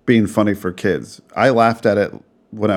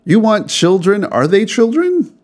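incredulous quote; 10 semitones higher, 3 dB louder than usual. Retold or imitated. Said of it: "if they're like".

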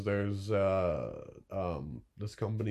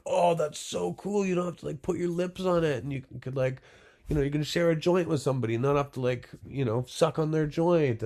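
high-pitched imitation of an enthusiastic buyer; impressed customer; 8 semitones higher, 4 dB louder than usual. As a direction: gush like a wowed customer.

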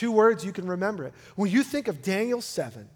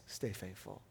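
usual speaking voice

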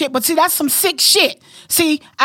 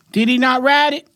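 ordinary delivery

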